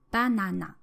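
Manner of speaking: banana high on BA, falling after it; high-first pitch accent. Shown as a demonstration. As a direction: start banana high, then step down for na-na. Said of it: "with the high pitch at the beginning of the word".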